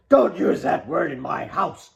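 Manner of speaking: father snapping a rebuke at the table; snapped. Acted. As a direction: cut her off hard. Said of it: "Karl snapped".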